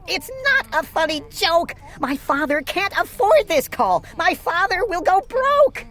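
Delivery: put-on a child panicking about money; frantic. A narrator's reading. Sister panicking about her father's bill, high plea. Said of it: "my little sister screamed".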